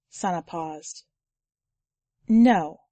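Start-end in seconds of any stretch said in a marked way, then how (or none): 2.3-2.8 firm. A narrator's reading. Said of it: "Sana became firm".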